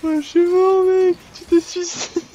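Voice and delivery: very high, loud squeal; excited shriek. Real, not acted. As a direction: shriek it out, cracking up. Teammate dies, howls laughing.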